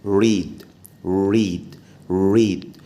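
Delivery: «ريد» with lips rounded; English R. Shown as a demonstration. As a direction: repeat «ريد», no Arabic tap. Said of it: "لازم تقولها ريد".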